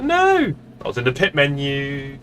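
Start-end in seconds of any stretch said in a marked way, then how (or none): 0.0-0.5 high-pitched mock cry of dismay. Streamer retelling his button panic, in high mock dismay. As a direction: exclaim it high, in playful panic. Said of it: "I was like".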